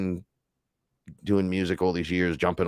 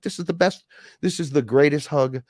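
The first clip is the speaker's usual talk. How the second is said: higher-pitched, overjoyed gush; gushing delight; 6 semitones higher than usual. Retold or imitated. Retelling the bird lady's hug, gushing. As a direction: gush like someone smitten.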